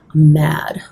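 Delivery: whispered, mock-grumpy confession; mock-mad whisper. Artist whispering her mock anger about the palette to viewers. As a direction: hiss it like a playful grudge.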